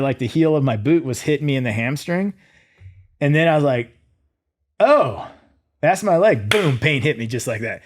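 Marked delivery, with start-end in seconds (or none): 4.8-6.5 realization. Retold or imitated. Realizing his leg is broken.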